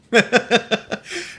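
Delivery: high, short run of chuckles; fond laugh. Real, not acted. Speaker who loves how Newfies talk, fond high chuckle. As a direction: chuckle with affection.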